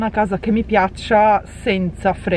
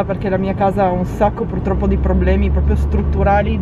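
usual speaking voice

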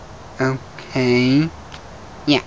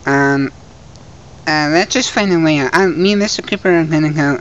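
ordinary talk

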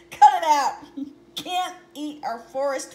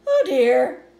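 mild dismay; 13 dB louder than usual. Acted. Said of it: "said her mother".

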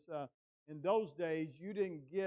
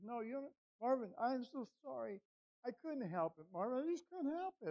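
higher-pitched pleading apology; imitating distraught wife; 8 semitones higher than usual. Wife pleading with her husband by phone, imitated, high and distraught. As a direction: voice her helpless, upset insistence. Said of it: "her voice was like this".

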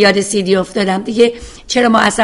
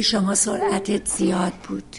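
calm police officer; 8 dB quieter than usual. Retold or imitated. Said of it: "میگه".

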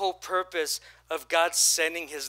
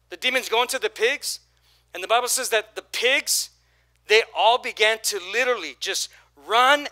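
normal talking voice

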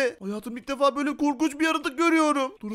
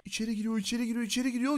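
soft, quick, low commentary; hushed watching; 3 semitones lower, 8 dB quieter, faster than usual. Pretend. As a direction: murmur it rapidly while spying. Secretly watches villain enter. (alçak sesle, hızlı hızlı)

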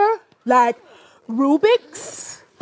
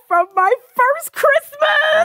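excited shout; 12 semitones higher, 3 dB louder than usual. Acted. Excited about old toy.

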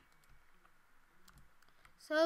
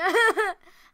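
loud, higher mock wail; mock crying; 6 semitones higher, 16 dB louder than usual. Player imagining a fall, pretend crying. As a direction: fake a loud burst of bawling. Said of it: "I'm gonna cry".

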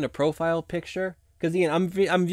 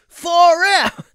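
high, loud surfer-dude yell; Mikey voice; 13 dB louder, slower than usual. Acted. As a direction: belt it like a hyped cartoon turtle. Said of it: "Can you express Mikey voice".

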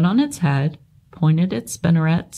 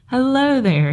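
friendly pig voice; 8 semitones higher than usual. Acted. Wilbur greets baby spiders.

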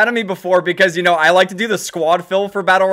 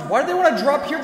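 ordinary talk, nothing marked

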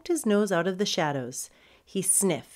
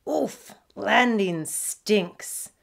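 a slow, drawn-out complaint after a grunt; grunt, then grumble it slowly; mildly acted disgruntled landing; crash-landing grumble; 3 semitones higher, 5 dB louder, much slower than usual. Pug crash-lands in fish barrel.

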